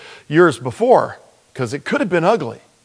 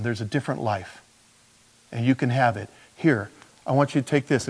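put-on soft, quieter, caring talk to tough kids; gentle appeal; 6 semitones lower, 7 dB quieter than usual. Pastor handing tracts to street kids, soft and caring. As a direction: reach out gently, no threat.

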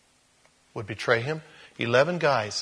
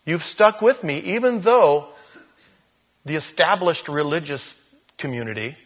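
raised, earnest voice of Jesus praising his followers; voicing Jesus; 4 semitones higher, 4 dB louder than usual. Jesus thanks the disciples who stayed, raised and earnest. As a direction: lift the voice high to speak as Jesus. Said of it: "And Jesus says".

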